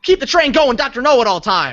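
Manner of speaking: loud, high segment call-out; hyped announcement. Pretend. Host launching the Doctor Know-It-All game, hyped call-out. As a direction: belt it out like a game kickoff.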